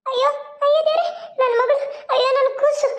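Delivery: put-on high frightened wail for her baby; mother wailing over her baby beside the snake; wailing in fear. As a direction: cry out as if the child is in danger.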